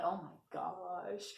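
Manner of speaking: soft, slow, shocked under breath; quietly stunned by the threat; slow hushed oh my gosh; scared gasp. Retold or imitated. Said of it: "I'm like".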